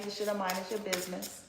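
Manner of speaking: ordinary talk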